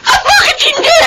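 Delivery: furious mother demanding to know where the father is; angry demand. Acted. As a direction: hurl the questions in a rage.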